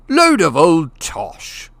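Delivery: loud, slow, blustering contempt; scornful outburst. Acted. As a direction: spit it out like a pompous bully. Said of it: "said Uncle Vernon".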